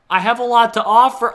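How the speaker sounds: loud, high-pitched retold protest; desperate plea. Rejected candidate pleading with interviewer, loud high desperate protest.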